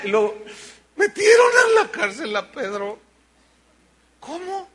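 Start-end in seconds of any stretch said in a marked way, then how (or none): 1.0-3.0 alarmed news. Retold or imitated. Disciple brings alarming news. 4.2-4.7 shocked. Retold or imitated. Disciple reacts to news.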